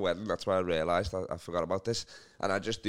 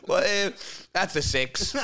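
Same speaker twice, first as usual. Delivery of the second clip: mock judging voice; 9 semitones higher, 4 dB louder, slower than usual. Mocking shoe shop customer.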